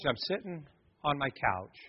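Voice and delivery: ordinary talk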